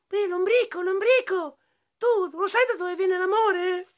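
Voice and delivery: very high put-on voice; high-pitched little character calling out; animal friend calling. Friends asking the worm about love, high squeaky voice.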